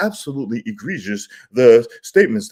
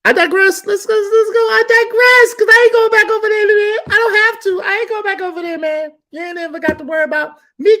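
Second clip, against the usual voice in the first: very high, loud hyped voice; wild excited rant; 6 dB louder than usual. Real, not acted. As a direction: rant it all out.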